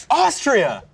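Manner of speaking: high, loud excited shout; excited cry. Acted. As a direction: shout it out delighted. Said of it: "with you as every character".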